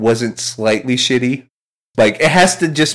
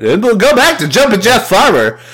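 high, punched-up showman voice; hammed-up showbiz announcer; showbiz mode; 11 semitones higher, 4 dB louder than usual. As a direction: pitch it like a radio host throwing back. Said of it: "I just go into, like, showbiz mode immediately".

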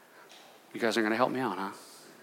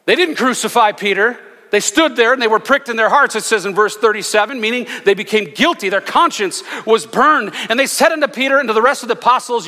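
full-force preaching; 5 semitones higher than usual. Acted. Preaching the crowd's guilt.

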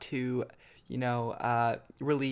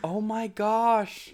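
high, slow, stretched oh my gosh; drawn out in disbelief; shocked; 7 semitones higher, much slower than usual. Real, not acted. Reaction to missing movie.

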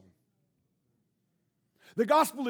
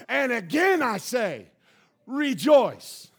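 preached; 5 dB louder than usual. Shown as a demonstration. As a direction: hammer it home one more time. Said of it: "let me preach it one more time".

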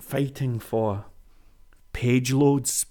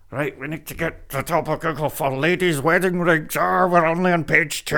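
higher, put-on fretting voice; mock stressed website owner; 4 semitones higher than usual. Website owner fighting for top of Google, mock fretting. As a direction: fret in a caricature voice about rankings.

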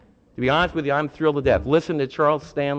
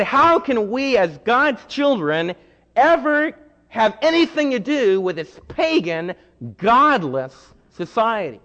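higher, louder indignant protest; indignant zealot; 8 semitones higher, 5 dB louder than usual. Retold or imitated. Zealots to the people, indignantly protesting Rome. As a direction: cry out against the pagan state.

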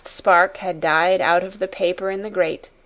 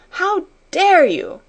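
much higher, slow, louder outrage; infuriated; 11 semitones higher, 5 dB louder, slower than usual. A narrator's reading. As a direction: stretch DARE into a high angry cry. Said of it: "she cried".

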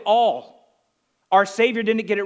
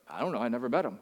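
much softer, lower, half under the breath; hushed puzzled reply; 8 semitones lower, 11 dB quieter, much faster than usual. Retold or imitated. Pastor friend in the store answering quietly, baffled and hushed. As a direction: murmur it like a baffled friend shrugging.

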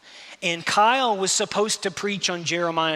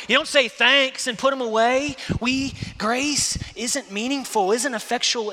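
loud, raised preacher's voice driving each point home; preaching at full force; 8 semitones higher, 5 dB louder than usual. Acted. Preacher urging the congregation to receive grace, loud and driving. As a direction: preach it out, pushing hard at the congregation.

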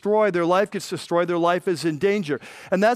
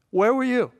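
clipped repeat, higher pitch; pointed rebuke; 5 semitones higher than usual. Retold or imitated. Lady Wisdom repeating her question to the fool, higher. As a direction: snap the short question back. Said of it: "And Lady Wisdom is saying".

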